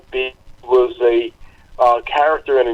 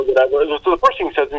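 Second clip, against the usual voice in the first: normal talking voice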